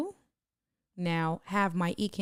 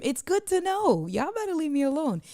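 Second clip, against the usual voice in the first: higher, quick mock warning; playful mock threat; 10 semitones higher, 4 dB louder than usual. Teasing chat viewers who caught her cheating, playful mock threat. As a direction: tease them like you're shooing them off.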